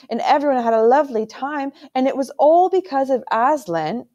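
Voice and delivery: put-on a child gushing about old stories; eager young boy. A narrator's reading. Young prince telling his uncle the Narnia tales, eager.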